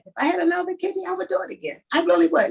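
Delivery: high, fast insistence; mean every word, push it up; emphatic. Real, not acted. Emphatic pledge to donate again.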